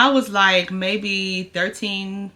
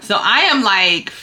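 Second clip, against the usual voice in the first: ordinary talk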